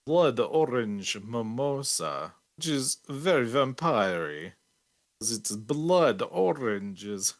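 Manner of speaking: put-on slow, drawn-out Transylvanian vampire accent; Dracula vampire accent. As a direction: say it like a hammy Transylvanian count. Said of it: "I'll stop doing that accent".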